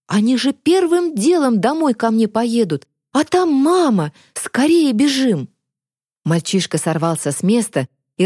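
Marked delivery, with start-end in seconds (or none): 0.1-5.5 raised, anxious pitch; urgent alarm. A narrator's reading. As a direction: urge a friend to run, alarmed. Boy panics about his mother. (встревоженно, торопя подругу)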